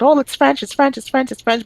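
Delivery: high, mock-fussy insistence; making a big deal. Mocking Quebec's fuss over French, high repetitive insistence. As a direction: chant it like a hammed-up fuss. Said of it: "make a big deal about".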